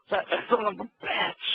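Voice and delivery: wrestling commentator impression.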